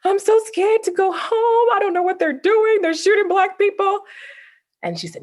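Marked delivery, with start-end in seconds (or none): 0.0-3.9 retold frightened plea, higher and fast; scared crying. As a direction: replay her younger self's panic, pitched up and rushed. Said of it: "I would run to her house crying".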